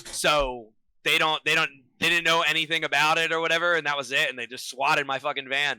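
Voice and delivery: ordinary talk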